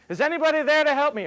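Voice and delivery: high, loud and hurried cry. Retold or imitated. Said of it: "he's yelling out".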